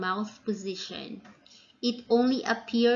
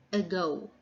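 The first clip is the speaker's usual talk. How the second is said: "ago" with the weight on "go"; unstressed schwa "a", then stressed "go" in "ago"; stress on second syllable; 2 semitones lower, 3 dB quieter than usual. Shown as a demonstration. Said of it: "falls on the second syllable".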